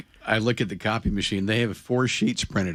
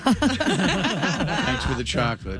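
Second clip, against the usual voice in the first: laughing; 6 semitones higher, much slower than usual. Real, not acted. Laughing thanks for chocolate.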